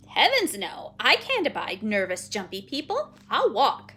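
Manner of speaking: loud, huffy put-down; indignant refusal. Acted. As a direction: refuse loudly and with disdain. Penelope indignantly refuses the ride.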